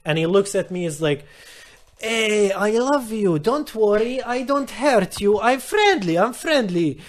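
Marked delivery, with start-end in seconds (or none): 2.0-7.0 put-on higher-pitched, over-friendly drunk; drunk man. Drunk stranger reassures man at the bus stop, drunk voice. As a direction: plead friendliness like a harmless drunk. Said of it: "he's completely wasted, he's drunk".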